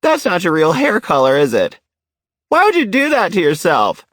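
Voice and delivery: retold shout. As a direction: squeal it out like a shocked old lady. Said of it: "and then she shouted".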